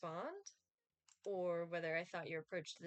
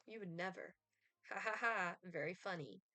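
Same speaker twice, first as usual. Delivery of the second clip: usual speaking voice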